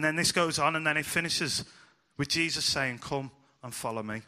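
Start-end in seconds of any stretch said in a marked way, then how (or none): 3.0-4.2 gentle invitation. Retold or imitated.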